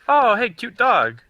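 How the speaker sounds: high, slow, gushing hello; cutesy greeting. Acted. Player greeting a dog owner, high gushing coo. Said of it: "I'm like".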